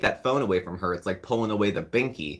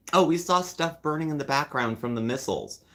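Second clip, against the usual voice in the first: higher, breezy mock of her tee-hee; 6 semitones higher than usual. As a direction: say it like a giggly aside. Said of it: "like it was a big tee-hee admission".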